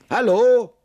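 loud, high hallo into a burrow; high, ringing call to whoever is inside. A narrator's reading. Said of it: "und so rief er".

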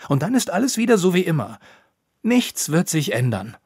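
fast, high, loud rebuttal; defensive insistence. A narrator's reading. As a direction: rush out an insistent argument. Defensive reply in a quarrel.

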